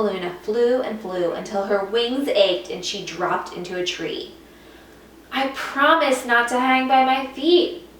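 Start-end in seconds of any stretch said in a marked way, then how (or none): 5.3-7.9 sighed. A narrator's reading. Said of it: "Stella Luna sighed".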